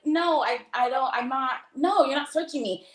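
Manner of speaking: flustered refusal, pitched high; retold protest.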